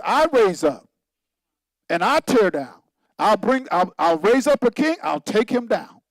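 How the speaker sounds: loud proclaiming quote; preaching voice of God. Acted. Preacher voices God raising and tearing down kings, loud. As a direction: declare it like God speaking.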